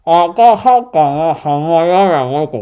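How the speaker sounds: slow, muffled through a gag; towel gag. Real, not acted. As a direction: sound out syllables past the gag. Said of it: "でも意外と分かるな".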